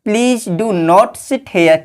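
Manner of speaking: each word drawn out for copying; slow dictation. Shown as a demonstration. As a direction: dictate it slowly to the class. Teacher dictates the English sentence. (धीमी गति से लिखवाना)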